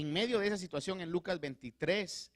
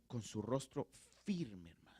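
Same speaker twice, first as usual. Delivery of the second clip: whisper; 5 semitones lower, 7 dB quieter than usual. Acted. Intense preaching whisper.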